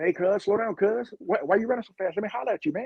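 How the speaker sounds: high, casual street hustler voice; drug dealer's call. Retold or imitated. A Skid Row drug dealer calling to a runner, high and easygoing. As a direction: sweet-talk the runner into stopping.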